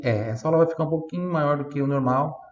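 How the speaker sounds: usual speaking voice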